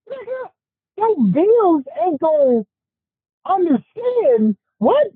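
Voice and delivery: high-pitched disbelief, drawn out at the end; mocking disbelief; incredulous. Real, not acted. Mocking the quitter's excuse.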